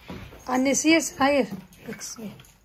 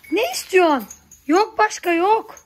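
louder and higher, telling the animal no; firm scolding; 4 semitones higher, 5 dB louder than usual. Real, not acted. Scolding the goat for more, a firm no. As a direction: put your foot down with a pet.

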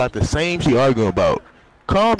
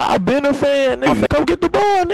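hyped shout; 10 semitones higher, 3 dB louder than usual. Real, not acted. Excited fan hyping in lobby.

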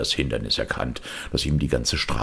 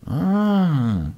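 long, slow satisfied sigh of relief; long contented ah; 3 dB louder than usual. Acted. Puppet sitting down home safe, long contented ah. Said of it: "mit einem langen, zufriedenen Ah".

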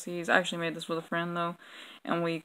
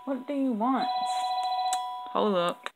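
real startled outburst, pitched up; 3 semitones higher than usual. Real, not acted. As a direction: jump at the sudden phone and snap out the question. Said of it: "that was actually really terrifying".

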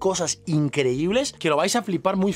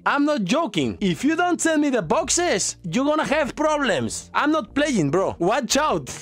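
mock threat; 6 semitones higher than usual. Video message threatening Tyler.

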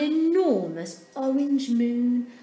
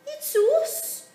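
very high, louder little penguin voices; little sisters; 6 dB louder than usual. A narrator's reading. Sisters knock at Dudley's door, very high. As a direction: chirp it up high like small sisters. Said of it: "said two of Dudley's sisters".

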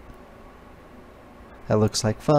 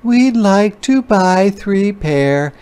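mama voice; 9 semitones higher, 4 dB louder, slower than usual. Acted. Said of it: "said her mama to the salesman".

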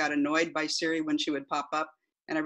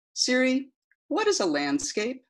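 repeating to voice assistant; 7 semitones higher than usual. Acted. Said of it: "this is the transcript of that conversation".

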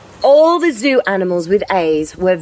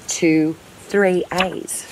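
ordinary delivery, nothing marked

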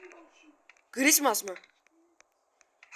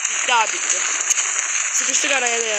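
panicked yelling; 4 dB louder than usual. Real, not acted. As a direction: yell in alarm. Panic as game goes wrong.

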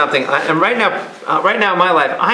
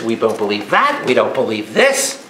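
low-pitched imitation of doubters, lifting each ending; imitated naysayers, pitched down; mock dismissive; 8 semitones lower than usual. Imitates people dismissing teachings.

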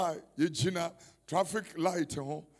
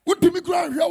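preaching at full force; 5 semitones higher, 12 dB louder than usual. Acted. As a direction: rouse them, louder line by line.

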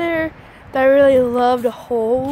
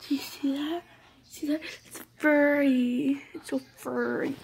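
whispering; 7 dB quieter than usual. Real, not acted. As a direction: whisper it like a secret. Whispering about furry lining.

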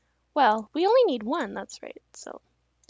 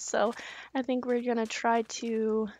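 usual speaking voice

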